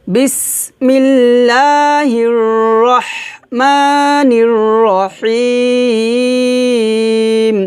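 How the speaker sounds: recitação muito lenta de "বিসমিল্লাহির রহমানির রহিম", vogais longas; recitação guiada. Shown as a demonstration. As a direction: conduza a repetição esticando muito o fim de "Rahim". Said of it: "আমার সাথে বলেন".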